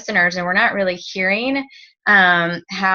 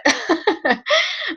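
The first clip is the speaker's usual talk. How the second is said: laughing. Real, not acted. Joking about a cheap date.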